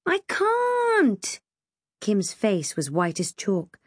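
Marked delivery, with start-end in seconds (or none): 0.1-1.4 very high, tearful wail; frightened wail. A narrator's reading. Kim clinging to the ladder, wailing in fear. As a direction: cry it out helplessly. Stuck girl panicking on ladder.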